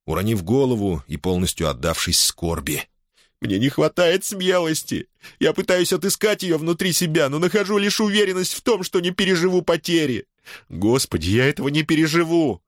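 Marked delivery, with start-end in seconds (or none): 3.4-12.6 high, tearful, breaking sobs; sobbing like a child. A narrator's reading. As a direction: weep it out like a child. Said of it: "Алонсо зарыдал, как ребёнок".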